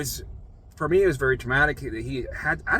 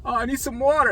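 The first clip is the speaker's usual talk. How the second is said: high, wailing imitation of a patient; moaning plea; 11 semitones higher than usual. Suffering patient begging staff for water, moaning. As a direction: beg in a drawn, much higher moan. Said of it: "moaning and growing, going".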